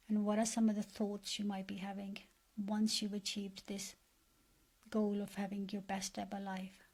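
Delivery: guided meditation. Acted. Coach leading listeners to picture their best life, guided meditation. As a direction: guide them gently, leaving space after each phrase.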